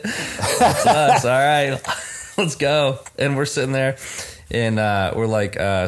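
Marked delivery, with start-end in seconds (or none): 0.0-3.0 higher and louder, words breaking into laughter; can't hold the laugh in while quoting him; cracking up mid-quote, loud and high; laughing through it. Retold or imitated. Guy with phone greets them.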